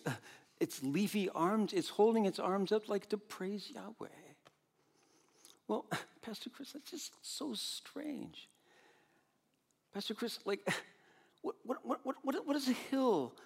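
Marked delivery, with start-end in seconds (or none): 5.7-13.3 high, soft, baffled mock voice of a listener objecting; puzzled congregant. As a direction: play a confused church member squeaking out a protest.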